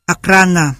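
"Akrana" with the stress on the middle syllable, kra; penultimate stress. Shown as a demonstration. Lecturer giving a Russian example of free stress, penultimate stress on "Akrana". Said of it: "ở âm tiết trước".

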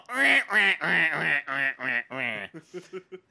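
drawn-out string of repeated nasal whines; repeated whiny imitation noise; imitated noise. Shown as a demonstration. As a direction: mimic a noise over and over, trailing downward. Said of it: "And the whole time I'm gonna go".

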